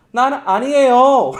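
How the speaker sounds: mock loud protest; accented denial. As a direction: Peter protesting too much in his dialect. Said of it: "이렇게 얘기하는 게 이미 걸린 거야".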